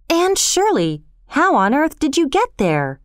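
lightly voiced boy, surprised and high; mildly startled question, pitch jumping up; confused. A narrator's reading. Gilbert finds Anne clinging to the pile, surprised. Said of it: "he asked with confusion".

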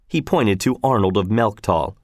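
ordinary delivery, nothing marked

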